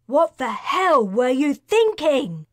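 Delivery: loud, high, scolding yell; yelling at them. A narrator's reading. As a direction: tear into them for their failure. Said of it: "Karina yelled".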